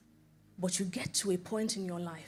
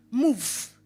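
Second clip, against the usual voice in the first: commanding voice of God; 5 dB louder than usual. Retold or imitated. God's command.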